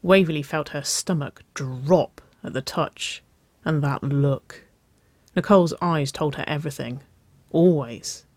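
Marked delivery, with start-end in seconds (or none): none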